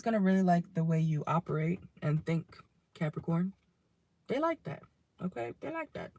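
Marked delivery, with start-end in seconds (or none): 4.3-5.5 much higher and softer, a teasing lilt on like; odd sing-song. Pretend. Tarot reader teasing her Capricorns, high and soft. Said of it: "I don't know why I said it like that". 5.6-6.1 much higher and softer, a teasing lilt on like; odd sing-song. Pretend. Tarot reader teasing her Capricorns, high and soft. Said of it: "I don't know why I said it like that".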